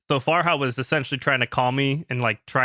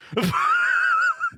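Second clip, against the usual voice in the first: shrieking laugh. Real, not acted. Laughing hard at the memory of the call, shrieking.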